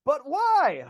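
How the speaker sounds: mock despair at learning tech presentations; incredulous why. Real, not acted. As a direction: stretch it out in disbelief. Mocking pointless effort.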